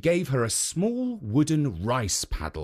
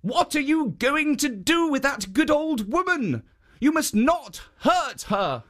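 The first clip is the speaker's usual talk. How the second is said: high, firm guardian warning; higher statue voice scolding the ogre; stern statue; 13 semitones higher than usual. A narrator's reading. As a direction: protect her, pitched high and firm. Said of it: "Then the Jitso said".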